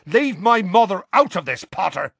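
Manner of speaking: high, loud furious retort; angry warning. A narrator's reading. Seamus warning Harry off his mother, loud and high. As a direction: warn him off, hot with anger.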